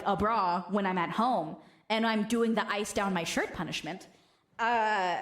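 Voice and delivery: ordinary talk, nothing marked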